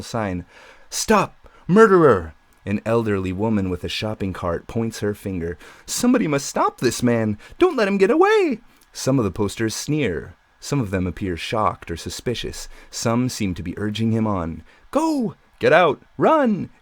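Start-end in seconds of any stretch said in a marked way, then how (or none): 0.9-2.3 high, loud accusing yell; cartoon police officer shouting at the killer. Cartoon police officer poster yelling. 5.9-8.6 rising to a high, loud shrieking alarm; old woman with a shopping cart crying out; elderly woman accusing. Acted. Elderly woman poster points, accuses. 14.9-16.7 high, loud urgent cries; urging shouts. Acted. Posters urging him on.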